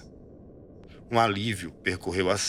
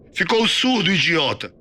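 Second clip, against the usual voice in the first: very high, loud insult; furious barracks bellow; angry sergeant yell; 9 semitones higher, 5 dB louder than usual. A narrator's reading. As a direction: shout him down like a drill sergeant. Sergeant berates recruit.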